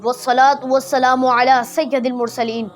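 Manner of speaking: ordinary delivery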